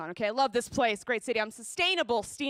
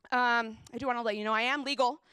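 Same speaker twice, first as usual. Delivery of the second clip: normal talking voice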